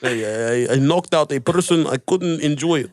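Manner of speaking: hammed-up, halting Russian-accented boast. Comic imitating a fighter father bragging, mock Russian accent. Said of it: "His father's Russian in my head".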